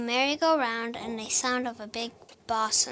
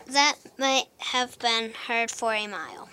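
ordinary delivery, nothing marked

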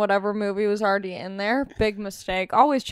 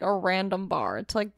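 ordinary talk, nothing marked

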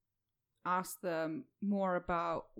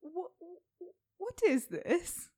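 surprised; 8 semitones higher, 5 dB louder than usual. Acted. Startled at a strange cage.

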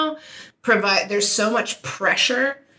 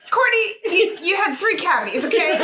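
mock dentist; 8 semitones higher than usual. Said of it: "They were like".

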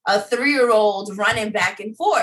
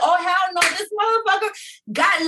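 mock outrage; 9 semitones higher than usual. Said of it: "and I'm like".

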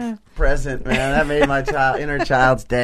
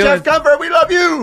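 shouted farewell; 10 semitones higher, 3 dB louder than usual. Real, not acted.

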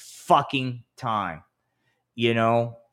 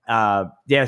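ordinary delivery

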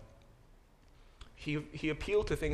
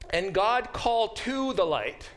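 "called TO the light", slow and raised, with "to" clearly sounded; preposition included; 8 semitones higher, 5 dB louder, slower than usual. Shown as a demonstration. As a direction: read loudly and high, spelling out "TO". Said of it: "there is a preposition there".

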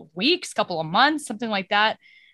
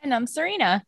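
a beat's delay before "And I'm Serena"; field-reporter delay; 5 semitones higher than usual. Real, not acted. Co-host introducing herself after the others, with a field-reporter delay. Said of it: "the weather girl delay".

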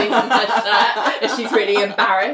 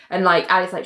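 usual speaking voice

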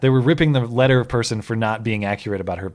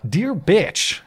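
higher, reading out the insult; reading the letter; 9 semitones higher than usual. Retold or imitated. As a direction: read it like a snide letter. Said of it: "as I said at the top".

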